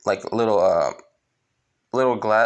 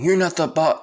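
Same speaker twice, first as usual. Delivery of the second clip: higher, louder protest, cut off; defiant villain; 7 semitones higher, 5 dB louder than usual. Retold or imitated. Villain with sword at neck, defiant. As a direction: blurt it defiantly, break off. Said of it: "With the guy saying".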